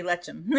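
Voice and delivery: "lechem" with CH as in "cheese"; mispronounced CH. Shown as a demonstration. Teacher showing the mistake, "lechem" said as "letch-em". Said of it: "you do not wanna say lechem".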